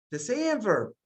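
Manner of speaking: usual speaking voice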